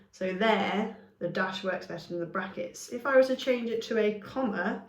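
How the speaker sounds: ordinary talk, nothing marked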